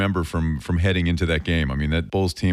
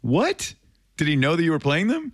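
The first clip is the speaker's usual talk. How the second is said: high-pitched surprised question; real astonished reaction; amused disbelief; 12 semitones higher than usual. Real, not acted. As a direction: jump up in pitch, incredulous. Host reacts to son's Bulls gear.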